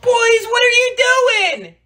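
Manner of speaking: loud, drawn-out demand; angry mom shout; scolding mother. Acted. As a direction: call out sternly to catch the boys. Mother catches the boys.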